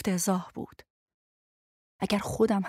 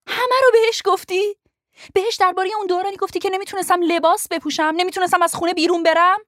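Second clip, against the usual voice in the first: high, loud, rising fury, rushing at the end; angry accusation; 9 semitones higher, 5 dB louder than usual. Acted. Lo furiously accusing Ben of spilling her secrets, high and loud. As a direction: hurl the betrayal at him.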